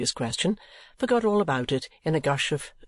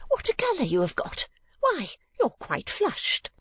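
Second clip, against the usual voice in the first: much higher, astonished mother; sudden exclamation. A narrator's reading. Mother remarking on her daughter's blush, sudden exclamation. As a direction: exclaim it high, as if just noticing. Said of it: "cried Missus Nickleby with her wonted suddenness".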